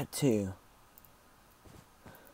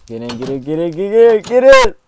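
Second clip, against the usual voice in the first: rising panicked shout, getting louder each time; frantic yell; 6 semitones higher, 15 dB louder than usual. Real, not acted. Gamer panicking as lava rises, yelling. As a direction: scream at yourself to get in.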